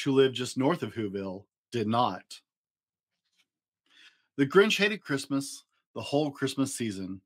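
ordinary delivery

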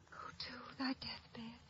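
breathy whisper, slow and soft. Acted. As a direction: breathe the line like a secret. Mad Ophelia whispering the dirge.

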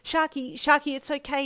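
urgent. A narrator's reading. Calming angry friend.

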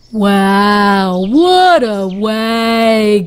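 a big lumbering walrus, drawn out; Walrus's voice. Acted.

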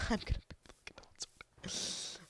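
hushed, giggly whisper; soft voiceless glee; excited whisper. Real, not acted. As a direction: whisper the good news to yourself. Gleeful whispered repeat.

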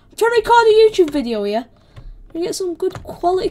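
fast, high and loud; indignant complaint. Real, not acted. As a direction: rush out an exasperated complaint. Complaining at attacker.

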